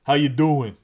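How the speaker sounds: hammed-up New York accent; New Yorker accent. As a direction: throw out a New York greeting. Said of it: "one of those New Yorker accents".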